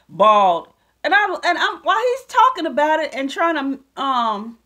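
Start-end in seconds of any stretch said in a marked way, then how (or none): 0.1-0.7 scornful emphasis. Retold or imitated. Scoffing at the doctor's word.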